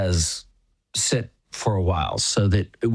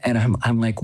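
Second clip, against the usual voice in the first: ordinary delivery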